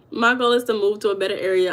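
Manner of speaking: ordinary delivery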